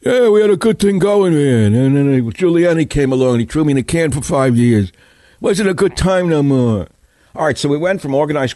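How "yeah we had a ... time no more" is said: mafia tough guy. Retold or imitated. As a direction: do the ex-con mobster from the show. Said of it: "The guy in the chair with the big arms".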